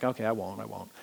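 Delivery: put-on low, very soft scolded-boy reply; hushed, half-hearted mock promise; meek promise. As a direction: give in quietly, like a told-off kid. Scolded boy promising, acted in story.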